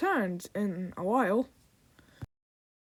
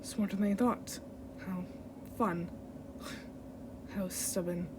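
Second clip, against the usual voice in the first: low, hushed, condescending villain; menacing villain; 5 semitones lower, 12 dB quieter than usual. Acted. Villain grudgingly taunting a mortal, low hushed menace. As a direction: sigh it out softly and darkly. Continues reading villain lines.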